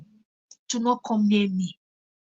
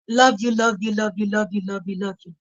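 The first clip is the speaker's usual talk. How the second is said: tender fading; 3 semitones lower, 4 dB quieter than usual. Real, not acted. Fading love repeats.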